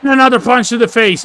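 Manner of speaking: ordinary talk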